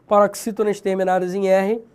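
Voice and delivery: ordinary talk, nothing marked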